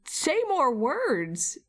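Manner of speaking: mock-awed American, high and drawn out; amazed gushing. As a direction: gush slowly like a starstruck fan. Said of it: "We'd all be like".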